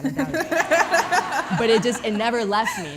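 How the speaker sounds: bursting into real laughter mid-sentence; laughing. Real, not acted. Laughing at herself about her thick Spanish accent. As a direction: laugh it out, words riding on the giggle.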